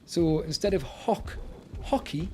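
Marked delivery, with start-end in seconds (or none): none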